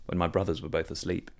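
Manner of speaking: plain reading voice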